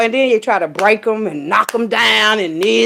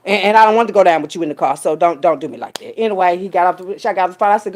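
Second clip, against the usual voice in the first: ordinary talk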